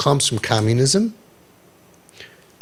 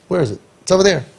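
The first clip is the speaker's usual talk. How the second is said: ordinary talk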